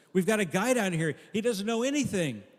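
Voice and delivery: high, loud, frantic angel voice; panicked angel. Acted. Angel running to Saint Peter, high and panicked. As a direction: rush it like an alarmed messenger. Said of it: "in a panic".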